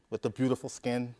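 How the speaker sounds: ordinary delivery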